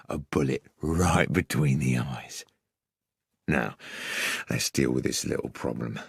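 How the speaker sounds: low, gruff crook; Tobias Organ. A narrator's reading. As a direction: menace them as a gruff killer, low. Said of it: "the gruff character is Tobias Organ".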